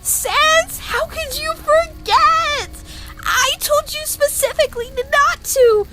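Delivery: high-pitched, distressed reproach; frantic scolding. Acted. Scolding a forgetful patient, frantic. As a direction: wail it out, exasperated with worry.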